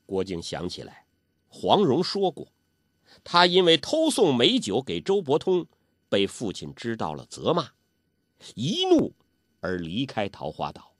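ordinary delivery, nothing marked

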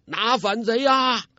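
high, slow drawn-out battle cry; shouting. A narrator's reading. Officer yells at soldiers to seize the rebels, drawn-out shout. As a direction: yell to rally the soldiers. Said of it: "另一名武官大叫".

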